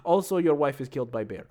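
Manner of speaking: put-on deadpan aside in a Russian accent. Brother ending his letter with bad news, Russian accent. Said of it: "I can do it in an accent".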